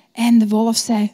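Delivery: plain reading voice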